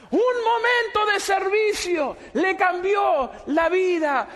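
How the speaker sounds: high, shouted revival sermon; full-force preaching. Real, not acted. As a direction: proclaim it to the whole congregation at full voice. (predicación encendida a gritos)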